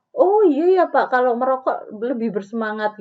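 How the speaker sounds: high, loud, eager agreement; wrongly agreeing. Shown as a demonstration. Counselor wrongly agreeing with a smoking client, eager. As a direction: gush along with the client. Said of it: "Bukan seperti itu".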